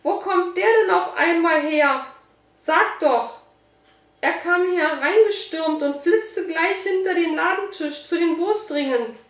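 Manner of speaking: high, loud upset questioning; flustered. A narrator's reading. Shop woman demands where the dog came from, flustered. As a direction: blurt it out, still shaken. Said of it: "Sie sah noch ganz verstört aus".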